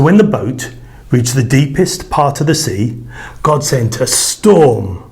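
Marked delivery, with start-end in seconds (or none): none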